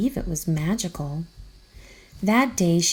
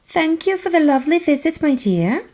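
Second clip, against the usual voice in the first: put-on light, high old-lady voice, a bit faster; sweet old aunt; 9 semitones higher, 4 dB louder than usual. A narrator's reading. Great aunt bidding the girl goodbye, sweet and high. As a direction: chirp it like a fond great aunt.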